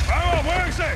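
high shouted command to move; barked order. Acted. Soldier urging troops through the gate, shouted order. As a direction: hurry the men along.

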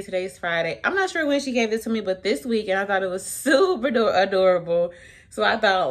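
usual speaking voice

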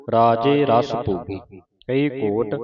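usual speaking voice

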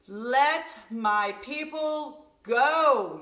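long, loud, slow demand; yelled plea. Acted. Moses demanding Pharaoh free his people, loud. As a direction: call it out strong and drawn out. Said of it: "yelled Moses".

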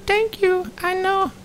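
a coy female creature, giddy; squeaky creature voice. Acted. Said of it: "that was horrible voices".

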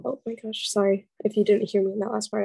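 ordinary talk

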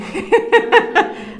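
a sudden high burst of laughter. Real, not acted. Preacher laughing at her own mob money joke, high and bright. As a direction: laugh out loud, amused at yourself.

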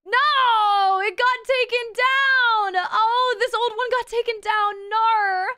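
loud, high, drawn-out wail; over-the-top howl of dismay. Acted. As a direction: cry out in mock anguish, dragging the words. Dismay at finding video taken down.

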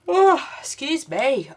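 a slow, loud yawn pulling the words out. Real, not acted. Tired crafter apologising to Jacqueline through a yawn. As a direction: let a yawn swallow the apology. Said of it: "Oh, stop yawning".

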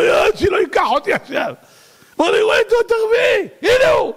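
very high frantic shouting; hammed-up terror, voice at the top; panicked king. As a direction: cry out in alarm, racing and shrieking. Acted king panicking at the angel.

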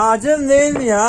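loud, high insistent shout; shouting demand. Real, not acted. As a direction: yell it to demand the truth. Demanding truth about spiciness.

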